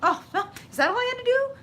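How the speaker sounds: high, fast and laughing; giddy, laughing and quick. Real, not acted. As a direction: laugh through the realisation. Laughing at own realisation.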